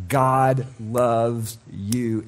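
very slow, soft and low; quiet assurance to the listener; tender declaration. Acted. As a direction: lay each word down gently. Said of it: "slow tender declaration".

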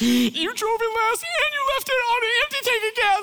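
high-pitched, loud blaming rant; hammed-up accusing outburst, far above normal pitch; mock accusation. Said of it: "you're blaming people".

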